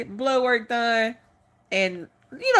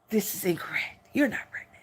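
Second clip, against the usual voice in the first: hushed doctor; 7 dB quieter than usual. Pretend. Imagined doctor breaking hoped-for news.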